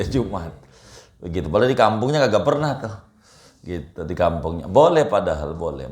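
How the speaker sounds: ordinary delivery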